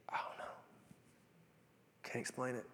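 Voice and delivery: a bare whisper of bewilderment; whispered doubt. Retold or imitated. Preacher voices his own confusion on the walk, hushed whisper. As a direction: breathe it out, almost inaudible.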